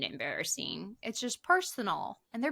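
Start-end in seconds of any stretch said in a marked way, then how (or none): 0.0-1.0 embarrassing said much lower and softer; low drawn embarrassing. Shown as a demonstration. Host repeats embarrassing, much lower and softer. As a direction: drop embarrassing deep and quiet.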